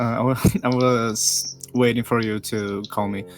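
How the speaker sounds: usual speaking voice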